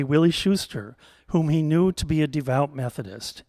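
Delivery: plain reading voice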